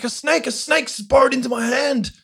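panicked. Retold or imitated. Son panicking to his parents.

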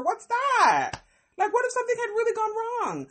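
high, near-cry disbelief; incredulous. Real, not acted. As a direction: pitch up, almost crying in outrage. Outraged at boys' river prank.

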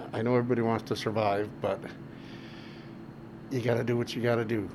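usual speaking voice

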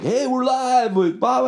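high, slow-rolling live radio host impression. Radio guy opening the live show, mock host voice. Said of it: "the radio guy's like".

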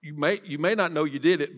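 ordinary talk, nothing marked

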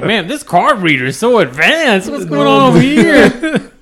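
high, loud, overdone wonder; feigned amazement. Acted. Embarrassed customer distracting from the cashier's look, feigning amazement.

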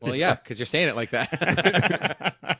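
cracking up while imitating the comeback; laughing. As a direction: deliver the punchline through laughter. Laughs finishing the retold comedy bit.

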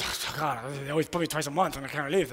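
low, soft, hurried moan; grumbling.

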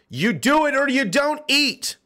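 high, drawn-out coercive threat; hammed-up bullying order; mock ultimatum.

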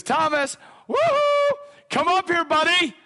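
high, loud hollering summons; playful calling out. Retold or imitated. Jesus calls Thomas up from the group, high playful holler. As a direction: whoop and holler to a pal.

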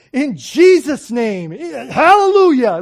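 loud, high, drawn-out praise shout; so excited. Retold or imitated. Mother ending the preacher's prayer, exuberant shout. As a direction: imitate an elated churchgoer crying out. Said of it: "Like, she was so excited".

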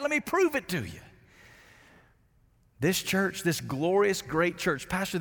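ordinary delivery

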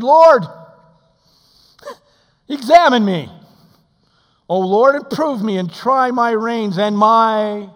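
loud, high prayerful cry; preacher crying out to the Lord; fervent prayer. Acted. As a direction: cry out to God with full force.

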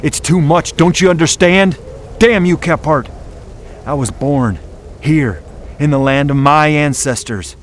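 high, loud anguished scream; screaming at Kephart in anguish. Acted. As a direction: scream it out in despair. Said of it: "Ketch fairly screamed".

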